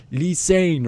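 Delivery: high, drawn-out call; imitated prompting. Man urging silent boys to repeat, insistent. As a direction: sing out the phrase as a prompt.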